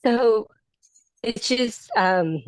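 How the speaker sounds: real emotion, halting with long pauses; fighting tears, stopping to hold it together; choked up. Real, not acted. Graduate thanking her coaching group, trying not to get emotional, choked up. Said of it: "I get emotional".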